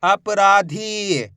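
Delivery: अपराधी very slow, रा and धी held; teacher drawing out अपराधी; blended slow reading. Shown as a demonstration. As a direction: join अपराधी slowly, stretching the long vowels. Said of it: "मिलके बन जाएगा".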